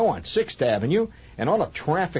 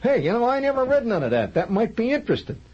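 much higher, loud working-class loudmouth; Archie Bunker voice; 11 semitones higher, 3 dB louder, faster than usual. Pretend. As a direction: play a blustering Archie Bunker type. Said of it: "how do you think Archie Bunker would react to that".

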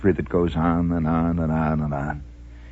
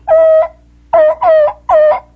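very high, loud repeated toots; little train whistle; 13 dB louder, slower than usual. Retold or imitated. Host imitating the Flying Scotsman's little whistle, piping. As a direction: toot it like a tiny whistle. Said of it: "its little whistle goes".

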